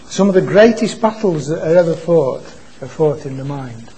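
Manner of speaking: ordinary delivery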